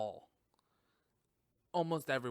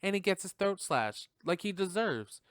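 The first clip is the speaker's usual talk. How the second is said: ordinary talk, nothing marked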